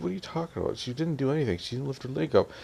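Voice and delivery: high-pitched imitated protest; mock-indignant comeback; defensive retort. Sister argues back in retold quarrel.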